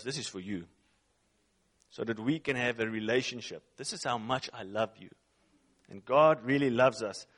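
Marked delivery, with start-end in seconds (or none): 0.0-5.1 soft, hushed and lower, speaking close; tender voice of God. Retold or imitated. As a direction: say it like a gentle promise. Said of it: "he turns to you and me, and he goes".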